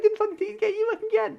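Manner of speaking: very high, loud comeback; mock quarrel. Farmer denying he took the key, mock angry screech. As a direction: squawk back in exaggerated denial. Retold row, guy answers back.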